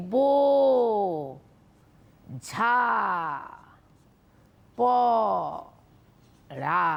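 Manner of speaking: "বো, ঝা, প, রা" split into four slow syllables with long pauses. Shown as a demonstration. Teacher to young students, showing the breath breaks, syllable by syllable. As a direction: break বোঝা পড়া into বো, ঝা, প, রা, pausing after each. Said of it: "কিরকম করে নিঃশ্বাস নিচ্ছি?".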